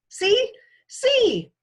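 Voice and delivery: put-on high, loud little kid calling; a toddler showing off, yelling; excited toddler. Toddler calling from jungle gym.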